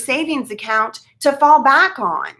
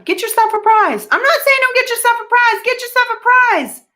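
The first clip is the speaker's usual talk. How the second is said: excited cheer; 14 semitones higher, 5 dB louder than usual. Acted. Cheerfully granting viewers a treat.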